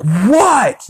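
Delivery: high, loud annoyed What?; snapped what. Retold or imitated. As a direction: yell back, irritated. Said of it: "And she's like".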